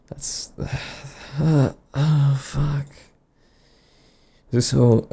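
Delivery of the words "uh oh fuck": ordinary talk, nothing marked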